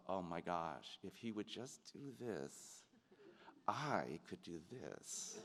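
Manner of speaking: soft, low mock inner voice; private frustrated thought. Actor privately judging a scene partner, quiet inner thought. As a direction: think it under your breath, drawn out.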